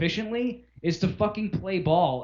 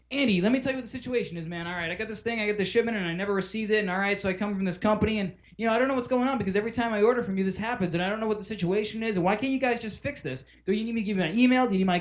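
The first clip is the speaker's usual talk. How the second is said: higher, hurried customer rant; launching into it; 4 semitones higher, faster than usual. Acted. Customer dumping a problem on Andy, rushed and rambling. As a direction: pile on details without letting Andy answer. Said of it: "if I just launch into this thing".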